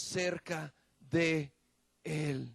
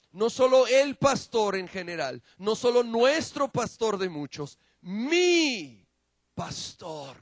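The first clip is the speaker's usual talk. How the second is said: "mi" punched higher and louder, then a hushed "pastor."; stress on mi; 5 semitones higher, 6 dB louder than usual. Shown as a demonstration. Preacher to the congregation, stressing "mi" in "mi pastor.". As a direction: build to "mi" and land it alone. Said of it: "lo enfatizó y enfatizó".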